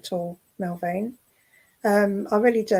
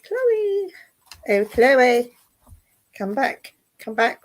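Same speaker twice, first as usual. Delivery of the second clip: high, drawn-out call of the name; sing-song call; 3 semitones higher, 4 dB louder, slower than usual. Real, not acted. Medium beckoning a lost spirit, high sing-song call. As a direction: coax her back with a high lilting call.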